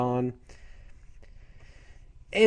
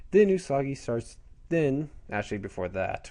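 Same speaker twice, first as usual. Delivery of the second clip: ordinary talk, nothing marked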